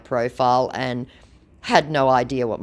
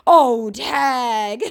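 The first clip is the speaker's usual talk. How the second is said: high, loud, stretched insult; mock scammer outburst; 11 semitones higher, 6 dB louder than usual. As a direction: imitate a riled-up scammer. Voices triggered scammer's insult.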